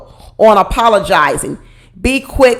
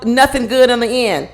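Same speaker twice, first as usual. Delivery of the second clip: ordinary talk, nothing marked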